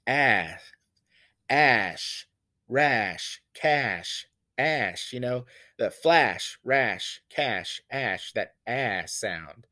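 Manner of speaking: ash, rash, cash said one by one, each leaning on the short a; slow list of the rhyming a words; stressing ash sound. Shown as a demonstration. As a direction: isolate each word and hit the a. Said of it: "Do you hear that?".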